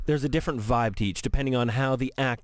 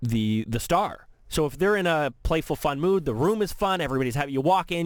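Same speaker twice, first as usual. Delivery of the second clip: ordinary talk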